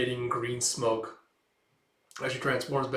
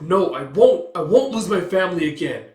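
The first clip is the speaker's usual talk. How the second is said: higher, much louder mock yell; shouted refusal; 6 semitones higher, 11 dB louder than usual. Witch refusing the group, shouting. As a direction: refuse flat out, loudly.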